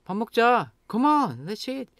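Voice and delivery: high-pitched coaxing; urging. Shown as a demonstration. Nagging a friend to come eat, urging. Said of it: "just changing the pitch".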